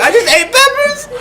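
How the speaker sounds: high, wailing words through sobbing laughter; laugh-crying. Retold or imitated. Telling the principal about the peppers, high laugh-crying wail. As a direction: blubber it out, half laughing, half crying.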